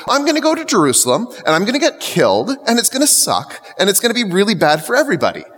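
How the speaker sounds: rattled off fast and high; tumbling out a casual doom list; quick mock prophecy. Preacher voicing Jesus to the disciples, fast and light.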